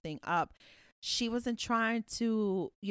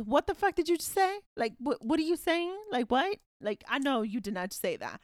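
acted disbelief with an edge; mock indignation; 6 semitones higher than usual. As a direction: let the hidden outrage out, pitched up. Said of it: "what I'm really saying is".

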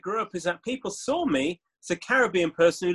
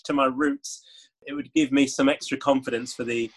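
ordinary talk, nothing marked

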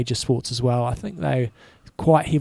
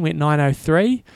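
usual speaking voice

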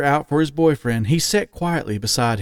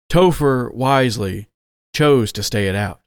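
ordinary delivery

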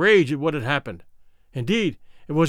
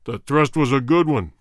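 warrior king; 3 semitones lower, 4 dB louder than usual. Acted. King approves the kill.

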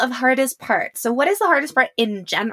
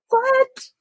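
high, stunned what; shocked what. Real, not acted. Host hearing the brow reveal, shocked.

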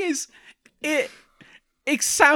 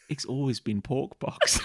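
soft solemn line; 7 semitones lower, 6 dB quieter than usual. Pretend. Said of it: "just looks at him and goes".

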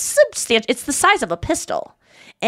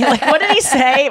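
ordinary delivery

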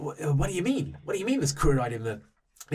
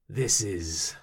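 low, slow, solemn warrior voice; a hint of a dour Viking chief, lower; grave warrior; 5 semitones lower, 5 dB quieter than usual. Kith warrior gravely declaring dishonour to the party, low and slow. NPC grave speech in accent.

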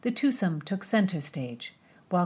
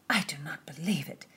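whispering; 4 dB quieter than usual. A narrator's reading. Disbelieving women, whispered.

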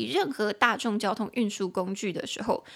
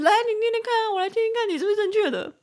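much higher, louder, bossy teacher voice, faster at the end; mock strict junior high teacher; 11 semitones higher, 4 dB louder, faster than usual. Teacher mockingly quizzing students, in a hammed-up bossy voice. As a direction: ham up a picky schoolteacher testing the class. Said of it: "我好像很机车的那种国中老师啊".

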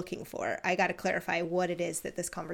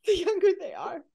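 amused echo; 10 semitones higher than usual. Real, not acted. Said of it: "echoing with delight".

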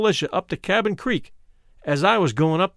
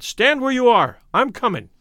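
calling out; 6 semitones higher than usual. A narrator's reading. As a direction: shout the order across the tracks. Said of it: "I called".

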